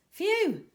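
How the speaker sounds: high, loud sigh of relief; relieved phew. A narrator's reading. Narrator relieved the shapes are dolphins, high loud phew. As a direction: blow out a big phew of relief. Relief after fearing sharks.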